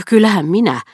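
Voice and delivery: loud, high protest. A narrator's reading. Swan protesting loudly against Odette. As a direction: push back hard. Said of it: "vastusteli Swan".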